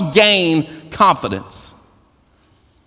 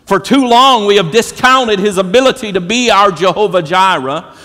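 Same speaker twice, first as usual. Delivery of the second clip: loud, high, building sermon cry; preaching at full force; 6 semitones higher, 4 dB louder than usual. Acted. Pastor preaching at full force to the church. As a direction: lift the church with rising, ringing declarations.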